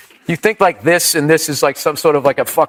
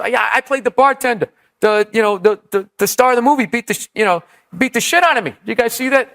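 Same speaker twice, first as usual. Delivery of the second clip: higher, quick imitation of a comic plugging his role; bombing comic impression; 7 semitones higher than usual. Bombing comic to silent crowd about his movie, eager mock patter. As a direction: play a comic fishing for recognition, pitched up.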